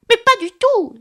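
loud, very high indignant outburst; a furious mouse protesting at full pitch. A narrator's reading. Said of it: "d'un ton furieux". (ton furieux, indigné)

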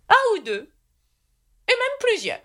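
high knight voice musing in separate bursts; pensive. A narrator's reading. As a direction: ponder it aloud, with a long pause. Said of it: "d'un ton pensif". (chevalier songeur, voix très aiguë)

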